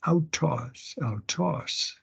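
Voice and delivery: "autos" begun on a clean vowel, no H; soft Greek "autos" with no breath before the vowel; smooth breathing. Shown as a demonstration. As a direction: sound out "autos" softly as "ow-tos". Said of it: "Smooth breathing".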